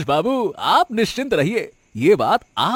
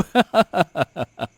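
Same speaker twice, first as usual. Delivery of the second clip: greedy laugh. Acted.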